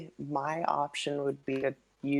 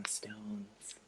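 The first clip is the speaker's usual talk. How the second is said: whispering; 3 semitones lower, 15 dB quieter than usual. Real, not acted. Trailing whisper at graves.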